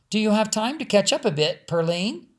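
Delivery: friendly hostess asking to chat; Missus Albright voiced.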